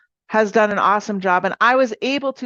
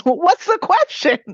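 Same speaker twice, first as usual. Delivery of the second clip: mocking quote; 9 semitones higher, 5 dB louder, slower than usual. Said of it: "I hated that when Sean would say that".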